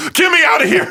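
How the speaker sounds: high, loud shriek, playing a terrified DJ; panicked mock scream. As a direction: drop the act and scream for rescue. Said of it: "before they drop it and go".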